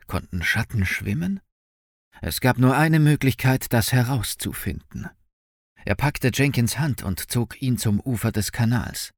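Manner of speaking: usual speaking voice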